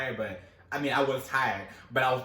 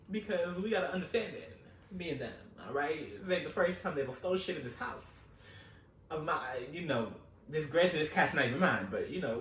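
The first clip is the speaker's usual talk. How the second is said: normal talking voice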